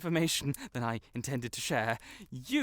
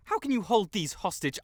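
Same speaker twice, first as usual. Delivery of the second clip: usual speaking voice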